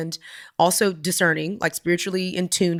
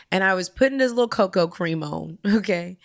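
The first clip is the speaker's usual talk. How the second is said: normal talking voice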